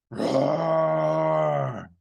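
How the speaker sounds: loud growling roar; lion roar. Shown as a demonstration. Teacher shows the children a lion roar. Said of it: "your best lion impression".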